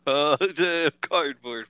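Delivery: drawn-out mock repeat of the word; slow odd echo. Friend mimicking the odd way he said cardboard. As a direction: stretch cardboard out oddly. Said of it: "Why did you say it like that".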